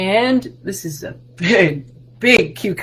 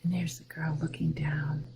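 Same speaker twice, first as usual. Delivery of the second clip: soft whisper; 4 semitones lower, 12 dB quieter than usual. Acted. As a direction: lean in and whisper it. Hushed aside during story.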